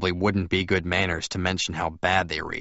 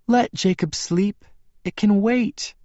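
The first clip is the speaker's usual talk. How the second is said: high, slow woman's voice; slow high-pitched plea; Bella's character voice; 14 semitones higher, 3 dB louder, slower than usual. A narrator's reading. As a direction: pitch it well up and draw it out. Bella's voice, then echoed by narrator.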